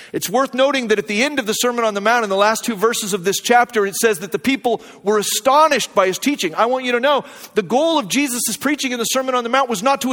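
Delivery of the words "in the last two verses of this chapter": ordinary talk, nothing marked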